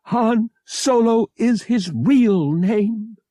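high, slow, loud insistence; crying out. A narrator's reading. Bria defending Han to Pavak, crying out. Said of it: "Bria cried".

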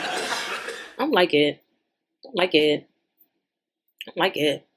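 soft dismay. Real, not acted. Watching the footage, a soft slow murmur of dismay. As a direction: murmur it, shaking your head.

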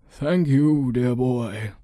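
lilting elder's voice; grateful old man. Acted. Old man taking his staff from the boy, grateful. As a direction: thank him as an old man.

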